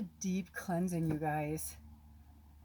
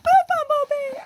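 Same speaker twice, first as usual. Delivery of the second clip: very high, loud reenacted shriek; retold scream; 13 dB louder than usual. Tractor driver screaming at a bumblebee, reenacted at full pitch. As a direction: shriek out to save the bee. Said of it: "And I was, like, screaming".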